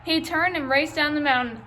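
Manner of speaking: ordinary delivery, nothing marked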